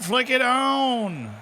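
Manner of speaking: slow, high "Flick it on" leaning on "it"; drawn-out urging. Acted. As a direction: stretch "Flick it on" slowly, leaning on "it". Repeating the urge, drawn out.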